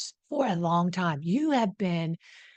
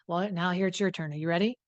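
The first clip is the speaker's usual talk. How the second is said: ordinary talk, nothing marked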